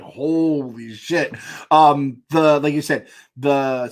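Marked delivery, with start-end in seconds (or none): 0.0-1.3 slow, drawn-out amazement; quiet stunned holy shit; awed. Retold or imitated. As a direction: let it sink in, stunned. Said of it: "I was like".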